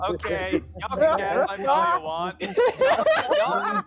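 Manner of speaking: high, loud laughing outcry; laughing protest. Real, not acted. Player laughing at the table, protesting loudly. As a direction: laugh it out in disbelief.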